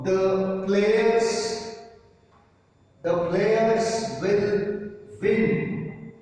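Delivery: very slow, each word drawn out; slow dictation. Shown as a demonstration. Teacher dictating the example to the class, very slow. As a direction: dictate it slowly so students can write. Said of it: "This is a simple statement".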